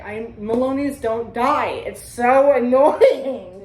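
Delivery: loud, slow, drawn-out complaint; hammed-up annoyed whine about not dying; mock exasperated. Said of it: "I was like".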